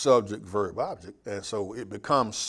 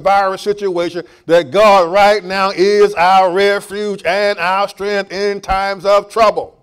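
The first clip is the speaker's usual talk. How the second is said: higher, louder, driving sermon; full-force preaching; 5 semitones higher, 9 dB louder than usual. Acted. Pastor proclaims God's power amid the virus, high and loud. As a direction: proclaim it over the church, full voice.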